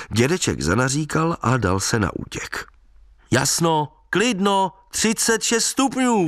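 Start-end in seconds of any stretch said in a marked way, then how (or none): 3.3-6.3 high, stretched-out call; high-pitched calling, no real shout; calling after someone. A narrator's reading. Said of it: "volal jsem za ním".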